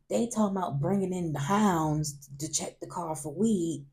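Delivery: normal talking voice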